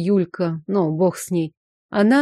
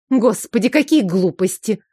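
ordinary delivery, nothing marked

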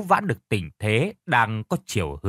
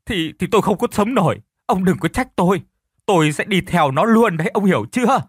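higher, stammering tearful threat; wife breaks down as she speaks; voice breaking into tears; 6 semitones higher, 4 dB louder than usual. A narrator's reading. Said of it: "Vẫn với cái giọng mếu máo".

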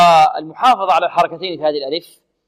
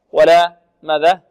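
ordinary talk, nothing marked

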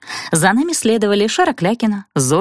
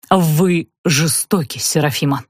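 slow, low, wounded reproach; mournful theatrical prince; lovelorn suitor; 6 semitones lower than usual. A narrator's reading. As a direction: languish over each word, sinking lower.

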